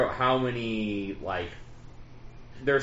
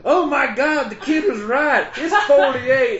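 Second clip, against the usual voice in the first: high-pitched country drawl, slow at the end; 12 semitones higher, 6 dB louder than usual. Retold or imitated. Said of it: "I'm doing an impression of a redneck".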